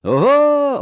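very high, loud whoop; roaring ritual response; crowd's answering shout. A narrator's reading. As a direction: answer the herald's call at full voice. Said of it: "odpowiedział tłum".

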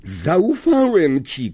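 high, slow cry of betrayal; furious shout. A narrator's reading. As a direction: throw the accusation out in rage. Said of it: "krzyknął do Lapina".

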